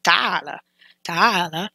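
"Taala" stressed firmly as a command; emphatic command. Shown as a demonstration. Teacher echoing the speaker's emphatic call. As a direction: give "Taala" a firm push. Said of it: "you can hear the emphasis in his voice".

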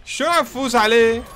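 high, loud amazed question; surprised shout. Real, not acted. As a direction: blurt out disbelief at winning. Surprised at winning. (صرخة دهشة عالية)

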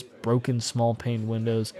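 ordinary delivery

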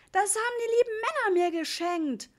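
high, loud bawling protest; whiny, howling kid; wailing child. A narrator's reading. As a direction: wail it like a small boy losing his presents. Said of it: "heulte Ritchie".

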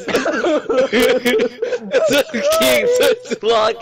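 laughing. Real, not acted. Laughing hard.

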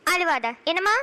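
ordinary talk, nothing marked

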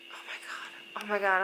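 quick whispered re-enactment; hushed disbelief. Retold or imitated. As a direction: whisper it as if barely daring to hope. Retold hushed hope nearing the house.

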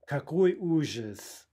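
slow, theatrical dismay; hammed-up horror, drawn out; mock horror. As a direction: play the tragedy slowly.

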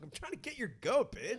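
high, soft taunting voice; mock taunting. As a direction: tease them sweetly and nastily. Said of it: "You're like".

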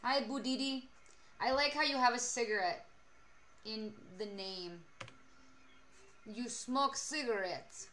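put-on deliberate Russian-accented speech, words spaced out. Streamer warns chatter about smoking cigarettes, mock Russian accent. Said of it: "Well, in Mother Russia".

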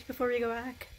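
ordinary talk

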